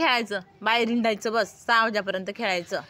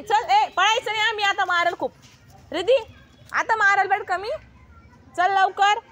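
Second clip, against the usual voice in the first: calling out; 9 semitones higher, 7 dB louder than usual. Real, not acted. Mother calling her daughters in from play, loud and high. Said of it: "एवढी केव्हाची ओरडती मी".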